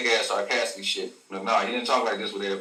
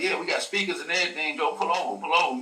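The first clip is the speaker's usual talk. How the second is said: higher-pitched retold call to pull over; imitated street hawker; 6 semitones higher than usual. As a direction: lightly act out an eager truck-side seller. Retold street hawkers calling from truck.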